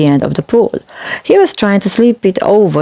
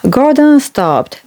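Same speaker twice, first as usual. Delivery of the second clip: usual speaking voice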